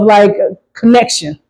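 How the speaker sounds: ordinary talk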